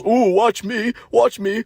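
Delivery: high, excited mimicking voice; mock showing off. Mocking the coworker who thinks he's funny, high show-off voice. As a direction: squeal it like a cringey show-off.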